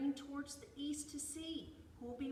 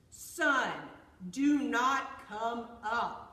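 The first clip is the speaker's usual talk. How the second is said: loud, slow bear command; 2 semitones higher, 16 dB louder, much slower than usual. A narrator's reading. Bear orders the sun not to rise, loud and commanding. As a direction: boom each word out slowly.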